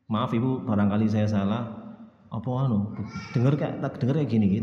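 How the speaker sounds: put-on a child politely correcting a mother, in a soft voice; soft humble apology. Shown as a demonstration. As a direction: speak softly as a respectful child. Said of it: "menurunkan intonasi suaranya".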